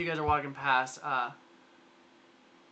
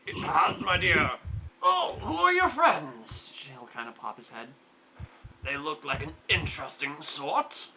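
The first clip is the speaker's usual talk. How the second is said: lightly voiced hearty old man, pleased and curious; a hint of a doting uncle sizing up guests; jolly portly uncle. Uncle eyeing his niece's friends, lightly voiced hearty and curious.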